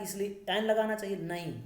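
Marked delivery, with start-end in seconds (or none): none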